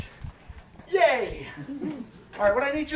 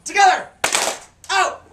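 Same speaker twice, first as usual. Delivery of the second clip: called cue; 7 semitones higher, 7 dB louder, much slower than usual. Shown as a demonstration. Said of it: "All right, now".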